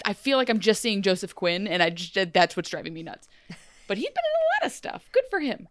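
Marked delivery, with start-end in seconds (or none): 3.9-5.7 squeaky proud voice; high-pitched praise. Real, not acted. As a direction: cheer him on in a high sing-song. Teasing praise for actor.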